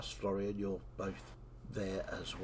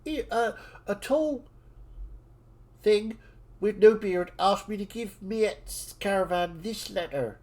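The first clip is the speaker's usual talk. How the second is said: put-on a squeaky child voice, much higher and louder; excited dwarf child; 10 semitones higher, 8 dB louder than usual. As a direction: gush like an amazed little kid. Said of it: "And the dwarven child goes".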